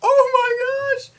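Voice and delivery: high, loud, drawn-out exclamation; hammed-up amazement; astonished. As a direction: stretch it out slowly in acted shock. Acts their shock at the man.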